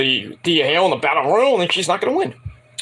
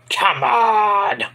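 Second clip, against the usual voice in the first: exasperated; 6 semitones higher than usual. Real, not acted. Exasperated at Duke's claim.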